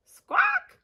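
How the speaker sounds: high loud bird squawk; gull squawk. Acted. Kelp gull answering Pip with a high squawk.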